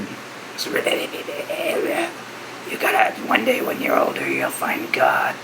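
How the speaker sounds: low, hushed demonic croak, imitating mom; raspy demon voice. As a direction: mimic a mother gone hoarse and demon-y. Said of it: "it sounds like a demon".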